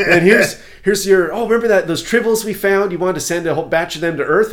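high, loud, eager crew-member voice; brief the captain with playful urgency; hammed-up starship officer; mock officer reporting. Officer presents papers to captain.

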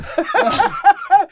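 high giggling burst; helpless laugh; real laughter; high laughing fit. Real, not acted. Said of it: "laughing fit".

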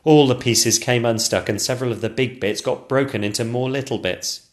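usual speaking voice

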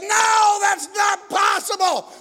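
high, drawn-out retold protest; loud disbelief. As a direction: cry it out as the disbelieving girl. Girl's disbelief acted out loudly.

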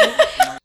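high little burst of laughter; brief real giggle; short laugh. Real, not acted. Mother recalling her brother dying laughing, giggle.

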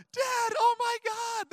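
high, drawn-out imitation of a wailing child; hammed-up kid's distressed wail; mock crying. Dad imitating his kids panicking as he drives past, mock crying. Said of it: "They're, like, crying".

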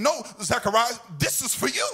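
high, ringing angel voice full of joy; the angel telling Zacharias to rejoice, voiced high and joyful; excited angel. Preacher voicing the angel to Zacharias, high and joyful. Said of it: "the angel of the Lord is saying".

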